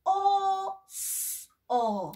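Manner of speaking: "o, s, o" split into separate sounds; letter sounds said one by one; sounded out. Shown as a demonstration. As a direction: segment the word into single sounds. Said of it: "si yo digo".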